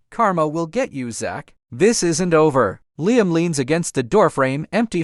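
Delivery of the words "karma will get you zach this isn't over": usual speaking voice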